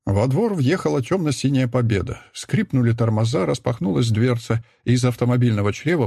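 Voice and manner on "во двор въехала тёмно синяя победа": ordinary delivery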